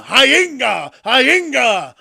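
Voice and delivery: put-on loud, high drunken whoop; mock drunken yell. Mocking the tipsy Rock with a loud high yell. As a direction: hoot it like a drunk. Mocking Rock's tequila brand.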